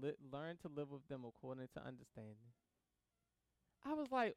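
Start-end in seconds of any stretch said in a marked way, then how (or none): 0.0-2.5 soft and low, as if remembering the verse; quiet reflective recall; inner recollection. Retold or imitated. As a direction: murmur the verse quietly to yourself. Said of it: "immediately went through my mind".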